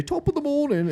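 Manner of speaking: high, loud mock British outburst; sudden British accent. Imitating him slipping into British mid-sentence, mock British accent.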